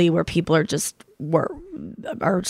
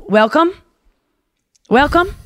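resort greeter; 7 semitones higher than usual. Retold or imitated. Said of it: "and he goes".

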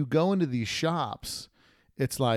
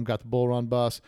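ordinary delivery

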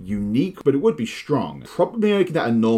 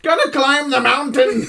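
put-on high, loud, excited old man; an eager old man raring to climb; sprightly old man; 5 dB louder than usual. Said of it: "is a very sprightly old man".

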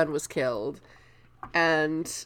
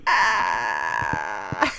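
mock horrified yelp; 11 semitones lower than usual. Reverend Mother's horrified outburst.

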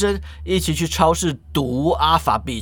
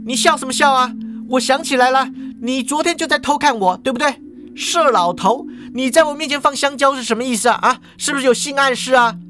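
high-pitched indignant cashier; suspicious, offended questioning; glaring accusation; 7 semitones higher than usual. Acted. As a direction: accuse him sharply, pitched well up. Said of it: "Alpha Beach瞪着他说".

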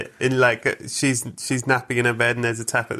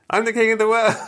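high, louder triumphant yell; triumphant cry; 9 semitones higher, 3 dB louder than usual. Retold or imitated. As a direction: crow it out like a winner. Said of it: "and then went".

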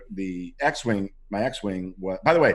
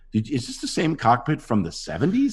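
high-pitched, exasperated comic complaint; mock incredulity; 7 semitones higher than usual. Actor finding rubber-cemented buttons in the cockpit, acting incredulous. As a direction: protest it in rising disbelief.

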